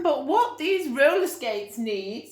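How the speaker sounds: storybook lady, teasing the next idea; Mrs Armitage musing. Acted. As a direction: draw it out teasingly. Mrs Armitage voiced again.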